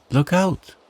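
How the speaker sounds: high, loud warning cry; sharp storybook warning, pitched well up; urgent warning. Acted. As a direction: call out a sudden alert. Frog warns girl of drowning.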